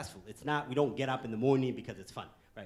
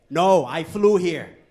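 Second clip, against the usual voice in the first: slow, loud, deadpan comeback; deadpan punchline; 2 semitones higher, 16 dB louder, much slower than usual. Acted. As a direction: land the joke slowly and loudly. Punchline answer to audience.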